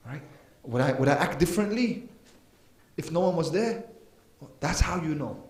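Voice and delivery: ordinary talk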